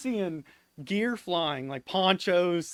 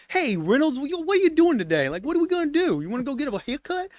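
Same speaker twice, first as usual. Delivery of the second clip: high, upbeat Boston guy impression; excitable high-pitched Boston voice; Shiloh; 11 semitones higher, 4 dB louder, faster than usual. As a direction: chirp it fast and eager, imitating an upbeat buddy. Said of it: "always upbeat".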